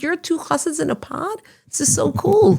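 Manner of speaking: high and thrilled; delighted, starstruck discovery; excited recognition. Retold or imitated. She recognizes the podcast host, high and excited.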